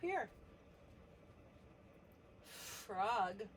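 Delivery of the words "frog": long F leading into Frog; stretched first sound. Shown as a demonstration. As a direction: hold the F, then finish Frog. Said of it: "Sounds like our letter F".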